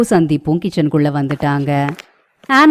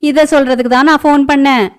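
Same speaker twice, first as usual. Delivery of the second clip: high, chirpy phone voice; cheery sister-in-law; 8 semitones higher, 4 dB louder than usual. Acted. Sister-in-law calling to announce a long visit, bright and high.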